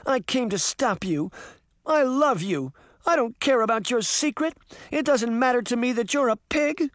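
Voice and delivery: high, urgent declaration of love; pleading woman in love; impassioned cry. A narrator's reading. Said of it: "the woman cried".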